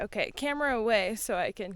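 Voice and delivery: ordinary talk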